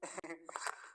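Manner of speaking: soft short giggle; suppressed laugh. Real, not acted. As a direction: let a small laugh escape. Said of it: "हंसी आ रही है".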